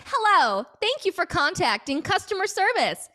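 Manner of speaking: high, bright phone recording voice, pleading please; automated message voice. Pretend. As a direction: play a customer service answering machine. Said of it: "acting as so, as, like, a automated message".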